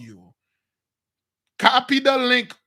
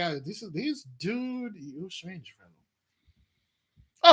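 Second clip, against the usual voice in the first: baffled onlooker; much slower than usual. Retold or imitated. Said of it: "People like".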